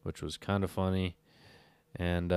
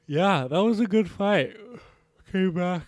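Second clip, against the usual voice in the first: high, loud, stretched through a yawn; 12 semitones higher, 5 dB louder than usual. Real, not acted. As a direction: talk through a big yawn. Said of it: "Excuse me".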